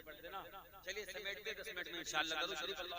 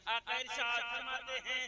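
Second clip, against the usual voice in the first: raised, slow and drawn out; solemn slow proclamation; 7 semitones higher, 7 dB louder than usual. Acted. Preacher solemnly announcing the Prophet's words. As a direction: proclaim it with reverence.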